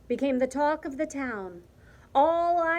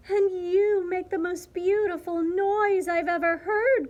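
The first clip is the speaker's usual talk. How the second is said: high, louder elated outburst; joyful cry; 7 semitones higher, 5 dB louder than usual. A narrator's reading. Martina praising the cricket, crying out joyfully. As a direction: cry it out, overjoyed. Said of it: "cried La Cucaracha".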